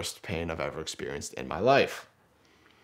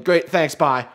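quick louder, higher thanks and goodbye; hurried brush-off; 5 semitones higher, 7 dB louder than usual. Retold or imitated. Injured man cutting short friends' directions, hurried brush-off. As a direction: cut them off and get away.

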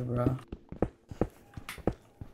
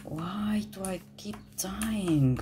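whispered exasperation; 6 semitones higher, slower than usual. Real, not acted. Exasperated at dying.

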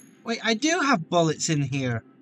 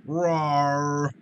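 low, drawn-out mock roar; half-hearted growled roar; 4 semitones lower than usual. Gamer reacting to a kill, playful mock roar.

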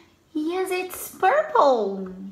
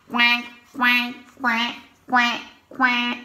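duck quacking; 5 semitones lower than usual. Pretend. Duck sound in picture book.

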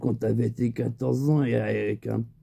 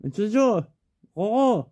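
Lascar's voice; 9 semitones higher than usual. Retold or imitated. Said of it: "Il me fait".

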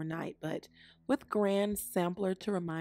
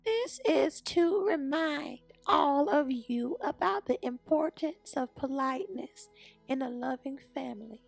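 high granny voice, gently instructing; kindly Gran; 7 semitones higher, 3 dB louder than usual. A narrator's reading. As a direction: remind the family in a high grandma voice. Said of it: "said Gran".

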